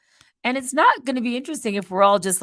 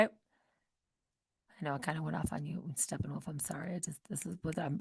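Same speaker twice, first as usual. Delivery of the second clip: quiet; 6 semitones lower, 16 dB quieter than usual. Real, not acted. Quiet apology to viewer.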